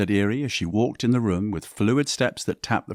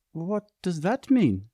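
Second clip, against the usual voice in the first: puzzled; 10 semitones higher, slower than usual. A narrator's reading. Katerina befuddled.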